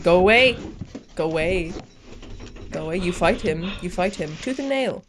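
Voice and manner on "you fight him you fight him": usual speaking voice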